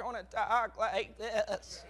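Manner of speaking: billy goat voice. Retold or imitated. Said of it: "they wanna talk like a billy goat".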